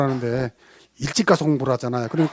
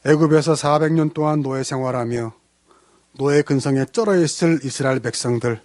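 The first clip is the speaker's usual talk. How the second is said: lower, earnest prayer voice; 3 semitones lower than usual. Acted. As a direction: pray sincerely for the congregation. Leads a prayer. (간절한 기도 목소리)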